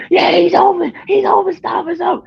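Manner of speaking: high-pitched excited imitation; very high, retold game-time excitement; excited cheering. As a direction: whoop it up like a thrilled onlooker. Retold excited reaction to the pass.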